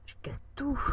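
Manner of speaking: whisper. Real, not acted. Mutters while breaking blocks.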